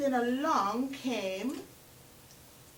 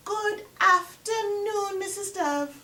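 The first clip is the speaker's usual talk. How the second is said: 1 sly Anansi greeting; 7 semitones higher, 4 dB louder than usual. Acted.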